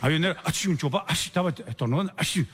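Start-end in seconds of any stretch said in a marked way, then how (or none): 0.4-0.7 a quick spoken achí sneeze; playful imitated sneeze; mock sneeze. Drunk man keeps sneezing. 1.1-1.3 a quick spoken achí sneeze; playful imitated sneeze; mock sneeze. Drunk man keeps sneezing. 2.2-2.4 a quick spoken achí sneeze; playful imitated sneeze; mock sneeze. Drunk man keeps sneezing.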